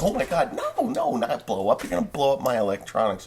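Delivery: hammed-up alarmed Secret Service agent; frantic, fast mock fear; panicked agent. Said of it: "he's like".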